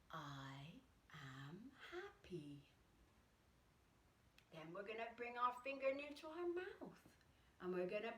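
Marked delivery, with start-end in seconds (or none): 0.1-2.6 slow, breathy whisper of the words; whispered affirmation. Shown as a demonstration. Yoga teacher shows kids a slow whispered affirmation. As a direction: whisper it gently as you breathe out. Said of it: "we're gonna say".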